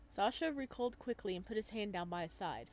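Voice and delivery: ordinary delivery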